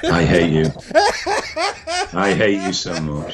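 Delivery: laughing mock outrage. Real, not acted. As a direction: groan it through helpless laughter.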